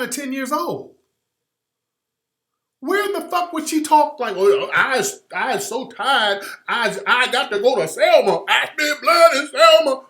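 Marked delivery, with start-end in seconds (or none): 4.4-10.0 John Lewis. Acted. Mocking how they made her talk, loud Southern caricature. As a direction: parody an old-time Southern speaker, building to a shout. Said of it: "got her talking like John Lewis".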